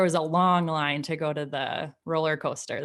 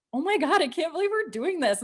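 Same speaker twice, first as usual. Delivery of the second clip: high, fast mock panic; 12 semitones higher, faster than usual. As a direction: retell the rising nerves in a high, rushed voice. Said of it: "started freaking out".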